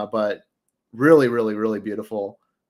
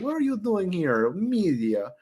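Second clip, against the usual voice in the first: imitated Russian accent, pitched up at first; 7 semitones higher, slower than usual. Retelling the stranger's question to him, mock Russian accent. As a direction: mimic an old Russian man's accent. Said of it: "in this Russian accent".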